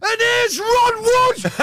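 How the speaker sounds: high, slow Scottish shout; broad Scottish accent. Retold or imitated. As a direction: bellow the intro in a Scottish accent. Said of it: "in this strong Scottish accent".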